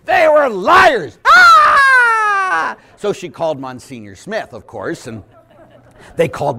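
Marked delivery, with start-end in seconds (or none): 0.0-1.2 slow, high shouted punchline; emphatic shout. Retold or imitated. Priest telling the woman off, slow shout. Priest retelling his retort. 1.3-2.8 high shouted gasp of outrage; mock shocked shriek. Offended woman reacting to the priest, mock shriek.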